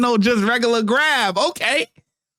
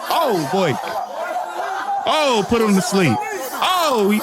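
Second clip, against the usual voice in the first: laughing. Real, not acted. Laughs at the chokehold.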